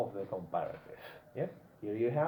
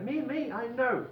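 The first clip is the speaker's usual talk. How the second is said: high-pitched, drawn-out "pick me" voice; playful mimicry of an eager learner; eager student; 9 semitones higher than usual. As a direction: imitate a pupil begging to answer. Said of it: "He wants to speak. He's like".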